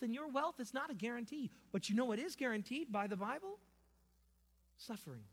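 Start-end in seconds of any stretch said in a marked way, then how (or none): none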